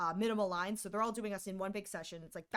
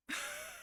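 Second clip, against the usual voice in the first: stunned laugh; 9 dB quieter than usual. Real, not acted. Disbelieving laugh after the reveal.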